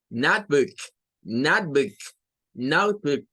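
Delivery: Notebook stressed on note, with book kept short; short final vowel. Shown as a demonstration. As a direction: model Notebook word by word, short oo. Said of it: "Es short. Es rápido".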